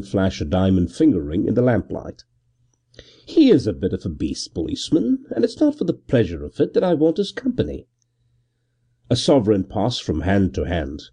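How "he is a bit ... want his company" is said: suave. A narrator's reading. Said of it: "Gervase interposed suavely".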